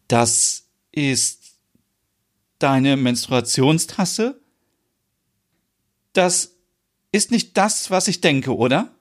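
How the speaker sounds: lightly voiced shocked Merit; a touch of disbelief in her questions. A narrator's reading.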